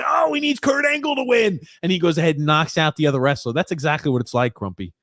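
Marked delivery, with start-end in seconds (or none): 0.1-1.6 mock wrestling heel. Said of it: "he's got, he's".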